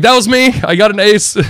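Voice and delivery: high, loud mock holler back; retold elated shout; excited reply. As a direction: call back proudly. His shouted answer to the onlookers.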